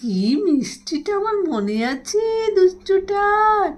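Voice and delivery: high, slow fond coo. Real, not acted. Owner praising her pet bird, slow high baby talk. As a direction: gush over the bird slowly.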